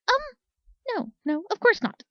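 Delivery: high, flustered, embarrassed denial. Acted. Blushing Speck denying to Claire, high flustered. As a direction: stammer it out, caught.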